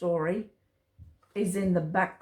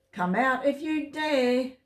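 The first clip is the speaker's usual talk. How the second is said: lightly voiced cat taunting the mice; taunting cat call; 6 semitones higher than usual. A narrator's reading. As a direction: dare the mice to come out. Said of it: "called Goliath".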